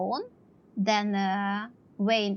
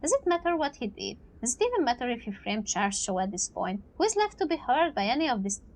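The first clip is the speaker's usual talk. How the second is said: ordinary talk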